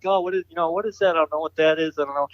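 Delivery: high, curious wondering at a strange car; puzzled wonder. Retold or imitated. Retells wondering at cars.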